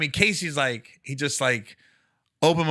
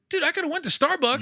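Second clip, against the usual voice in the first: higher, incredulous complaint; exasperated disbelief; 12 semitones higher than usual. Retold or imitated. Comic complaining to the manager about the detour, incredulous and high. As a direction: replay the outrage, pitched up high. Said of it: "I go".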